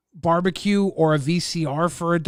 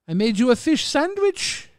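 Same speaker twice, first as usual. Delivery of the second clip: goofy quoted line with a changed voice; a lighter, higher second take of the quote; movie quote voice; 7 semitones higher than usual. Retold or imitated. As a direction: repeat the movie line in a character voice. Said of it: "a line from Freddy Got Fingered".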